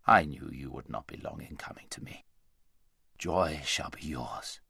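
low, unhurried blessing; tender great lion. A narrator's reading. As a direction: bless her gently in a low voice. Great lion tenderly greets mare.

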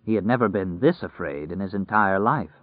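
ordinary delivery, nothing marked

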